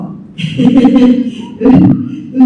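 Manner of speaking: a long, genuine run of laughter; laughing. Real, not acted. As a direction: burst out in a long chuckle. Amused after own remark.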